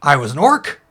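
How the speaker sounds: a small shellfish who insists he was once an orc; cursed oyster. Acted. GM voicing the cursed oyster to the elf, indignant.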